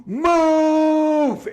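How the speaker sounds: high, loud comic scream; hammed-up slapstick yell; screaming move. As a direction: bellow it at the top of your lungs like Benny Hill. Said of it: "he screams at the top of his lungs".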